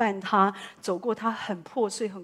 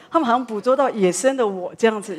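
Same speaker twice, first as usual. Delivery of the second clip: usual speaking voice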